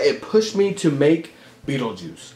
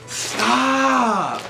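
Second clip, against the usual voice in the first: squealed stop; 6 semitones higher than usual. Real, not acted. Overwhelmed by fan gift.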